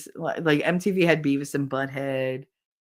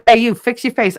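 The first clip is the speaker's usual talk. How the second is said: loud, raised call-out; teacher's scolding; 6 semitones higher, 8 dB louder than usual. Retold or imitated. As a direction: imitate a teacher telling a kid off. Teacher scolding a kid, retold.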